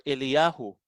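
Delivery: "Eliyahu" as eh-lee-YAH-hu; Hebrew pronunciation. Shown as a demonstration. Teacher gives the other Hebrew form, "Eliyahu" as eh-lee-YAH-hu. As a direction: say "Eliyahu" with a clear y and final hu. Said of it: "ang Elijah sa Hebrew ay ganito".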